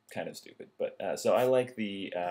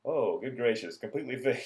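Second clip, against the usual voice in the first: mock amazed, higher pitch, cut off with a laugh; hammed-up sarcastic amazement; sarcastic gasp; 5 semitones higher, 4 dB louder than usual. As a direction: fake wonder at a miracle cure. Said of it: "he's just like".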